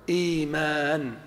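إيمان with a long ī, slowly and clearly; drawn-out model: إيمان, no glottal stop inside; correct form. Shown as a demonstration. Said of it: "فيقولون".

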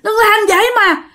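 high-pitched acted cry of a widow; wailing protest. A narrator's reading. Wife begs in-laws not to open the coffin, wailing protest. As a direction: plead in distress, voice held high. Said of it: "la làng lên quá".

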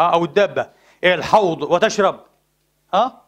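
ordinary delivery

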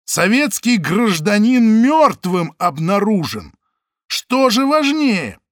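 slow, loud, high rebuke; indignant. Acted. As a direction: shame the official. Самойлов возмущается. (возмущённая отповедь)

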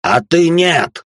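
high, loud, drawn-out accusation; angry shout. A narrator's reading. Demon turning on the summoner over reward, shouting angrily. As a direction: throw it out loud and slow.

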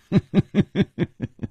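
brief falling laugh; a rueful little laugh; short laugh. Acted. Nan Gongling admitting defeat with a short laugh.

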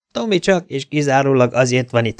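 usual speaking voice

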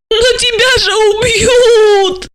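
very high, drawn-out tearful wail; howling. A narrator's reading. Asya despairs for Gorokhov's life, wailing long and high. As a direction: howl it slowly, stretching the last word. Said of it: "Ася взвыла".

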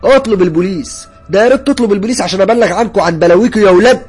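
high, loud outraged shouting; defiant fury. A narrator's reading. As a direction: throw the threat back at him, yelling. Defiant answer to blackmailing caller. (تحدي وزعيق بغضب)

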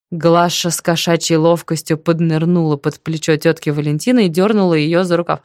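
ordinary delivery

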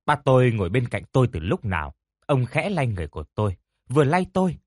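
plain reading voice

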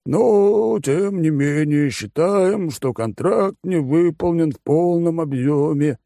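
high, slow, lazy condescending voice; bored rich glutton explaining terms; smug fat oligarch. Acted.

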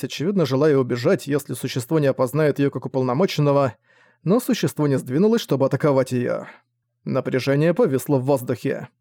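usual speaking voice

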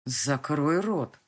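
put-on man threatening the girl to shut up. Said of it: "с угрозой в голосе проговорил он".

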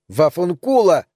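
high-pitched angry cry; shouted curse. A narrator's reading. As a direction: yell a rude insult. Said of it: "крикнула она".

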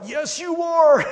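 loud, high, slow retort; body talking back. Retold or imitated. The body answering your denial, loud slow retort. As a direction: drag out each word as the scolding body.

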